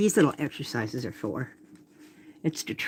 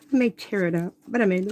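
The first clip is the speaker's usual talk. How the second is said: talking through hiccups; small hiccups breaking the words; 3 semitones higher, faster than usual. Real, not acted. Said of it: "I've got the hiccups".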